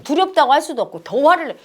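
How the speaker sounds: usual speaking voice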